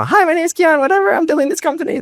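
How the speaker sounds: very high-pitched, quick self-parody; imitating younger self. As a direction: rush the pitch in a comically high voice. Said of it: "That's probably, you know, what I sounded like".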